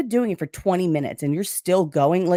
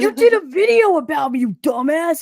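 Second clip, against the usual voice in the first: loud, high mock scolding; indignant imitation; 9 semitones higher, 5 dB louder than usual. Man in the chat reminding a streamer of a video, indignant. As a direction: scold the forgetful friend, pitched up and loud. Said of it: "Josh was like".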